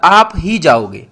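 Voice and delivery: "आप ही जाओगे" said slowly with weight on ही; stress on ही. Shown as a demonstration. Teacher showing students how a particle takes emphasis, stress on ही. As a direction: lean hard on ही, drawing the phrase out. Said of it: "ही पर ज़्यादा जोर दिया".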